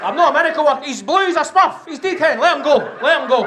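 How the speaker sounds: high, loud pleading; mock exasperated paramedic. To the grieving family, high loud pleading. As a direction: plead to let the old man go, high and loud.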